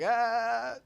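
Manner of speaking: high, hesitant reluctant whining; reluctant whine. Retold or imitated. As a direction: play it as a reluctant player dodging the coach. Imitating his own reluctant younger self.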